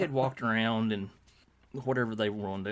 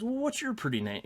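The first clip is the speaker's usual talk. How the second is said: much higher, sweet-talking retold voice; talking to children; 12 semitones higher than usual. Actor asking little girls their names, imitated child-directed voice. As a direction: imitate a man cooing to a little girl.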